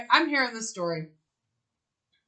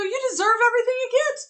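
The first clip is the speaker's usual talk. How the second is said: retold scolding; 11 semitones higher, much faster than usual. Retelling a quarrel with a cheater.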